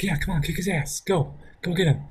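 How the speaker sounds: high, soft jeering sidekick; mock egg-on from a punk kid; a kid goading a fight, high and soft. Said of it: "trying to egg him on too".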